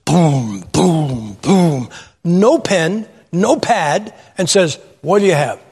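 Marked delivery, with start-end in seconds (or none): 0.1-1.9 low, spaced-out booms; imitated heavy footsteps. Huge young waiter stomps out to the table, low heavy booms. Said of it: "He comes out like this". 5.0-5.6 lightly voiced hulking waiter; a hint of a huge kid demanding the order; big brusque waiter. Huge young waiter asks diners for orders, lightly imitated brusque voice. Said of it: "He comes out like this".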